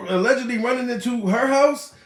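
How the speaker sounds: normal talking voice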